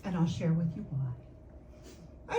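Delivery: normal talking voice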